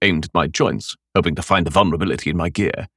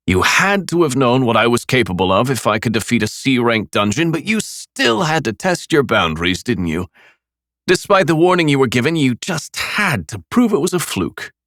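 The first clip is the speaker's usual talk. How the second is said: mocking; 5 semitones higher than usual. A narrator's reading. Killer blaming Nick for his friends, mocking. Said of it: "I continued mocking him".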